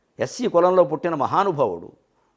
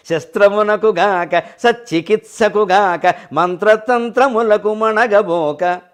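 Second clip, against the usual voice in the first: slow, drawn-out verse chanting; poem recitation; much slower than usual. Acted. Preacher reciting Joshua's verse to the audience, slow and chanted. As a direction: chant it slowly, savouring each word.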